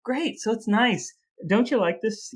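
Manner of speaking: ordinary talk, nothing marked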